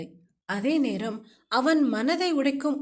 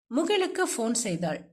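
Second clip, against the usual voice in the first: plain reading voice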